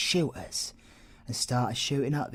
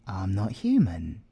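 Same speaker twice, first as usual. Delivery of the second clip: ordinary talk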